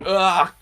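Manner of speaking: sudden high loud yelp; startled cry. Acted. Boy yelping as milk pours over his head. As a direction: cry out as cold milk hits.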